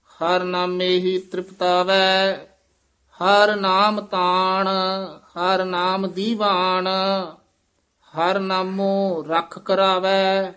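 measured chant-like reading with drawn-out line endings; scripture recitation. Shown as a demonstration. Teacher reciting Gurbani for students, measured chant. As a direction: intone each line on a level note.

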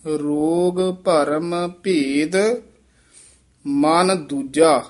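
slow, separated words with a long rest after ਭੇਦ; careful pauses. Shown as a demonstration. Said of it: "ਇਹ ਵਿਸਰਾਮ ਧਿਆਨ ਨਾਲ ਲਾਉਣਾ ਹੈ".

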